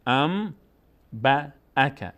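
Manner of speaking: ام با اک in separate syllables, held m; n turned to m. Shown as a demonstration. Teacher models the rule, ام با اک syllable by syllable. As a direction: hum ام, then با, then اک.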